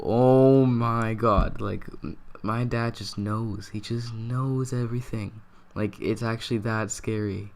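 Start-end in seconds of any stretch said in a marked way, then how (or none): 0.0-1.6 loud, slow, drawn-out "oh my God"; stunned exclaim. Retold or imitated. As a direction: blurt it out in shock, stretched. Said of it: "And I just go".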